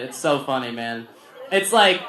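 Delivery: normal talking voice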